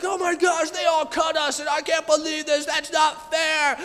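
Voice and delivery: hammed-up upset shout; mock outrage. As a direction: whine loudly like a crowd that was cut in line. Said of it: "like, all mad".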